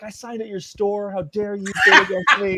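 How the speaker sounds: high-pitched mock outrage; retold heckle, high and rising in volume; mock heckling. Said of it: "we're just heckling each other".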